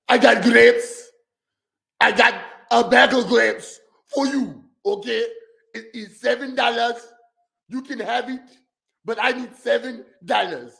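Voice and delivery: imitating a gravelly-voiced African accent, pushier seller. Reactor mimics a gravelly African grape seller, mock African accent. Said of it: "the, the gravelly, uh, African".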